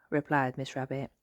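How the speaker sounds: ordinary delivery, nothing marked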